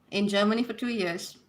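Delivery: normal talking voice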